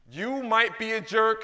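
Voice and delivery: loud, slow call-out to the crowd; leading a call and response, shouted. Real, not acted. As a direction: belt it out to the room, slow and loud. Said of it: "I'm gonna shout out".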